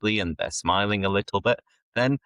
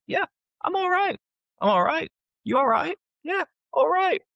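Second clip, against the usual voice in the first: high-pitched mock small talk; quick high-voiced hello and reply; chirpy greeting demo. Shown as a demonstration. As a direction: act out a casual exchange, pitched right up. Acting out everyday greeting replies.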